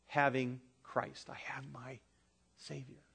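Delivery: hushed awe over the Savior; soft reverent hush. Real, not acted. Preacher confiding his faith in a hushed whisper.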